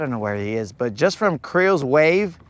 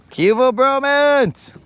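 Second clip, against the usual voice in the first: hype shout; 10 semitones higher, 4 dB louder, slower than usual. Real, not acted. Hyping the stream's arrival.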